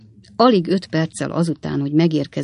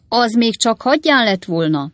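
mild, higher-pitched bellow; raised-voice fat boy; 6 semitones higher, 4 dB louder than usual. A narrator's reading. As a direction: bellow it into a deaf ear, held back. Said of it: "bömbölte a kövér fiú".

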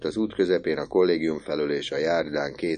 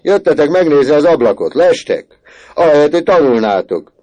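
much louder and higher angry yell; furious shout; 9 semitones higher, 12 dB louder than usual. Acted. Teacher yells at the two boys from the window, furious. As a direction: tear into the lazy boys. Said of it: "kiáltott ki".

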